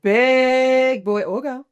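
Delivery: dragged out teasingly; slow. Real, not acted. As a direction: stretch it out with emphasis. Playful correction, drawn out.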